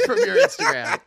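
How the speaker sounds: high, loud laughing squeal; laughing so hard it squeaks; burst of laughter. Real, not acted. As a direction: crack up on the punchline. Teasing reveal, laughing.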